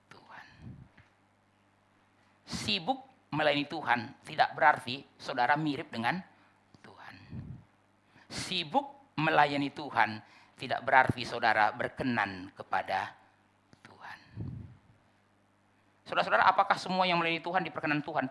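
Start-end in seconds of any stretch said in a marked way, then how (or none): 0.1-0.5 barely voiced word; hushed final word; whispered ending. Real, not acted. 6.8-7.2 barely voiced word; hushed final word; whispered ending. Real, not acted. 13.9-14.2 barely voiced word; hushed final word; whispered ending. Real, not acted.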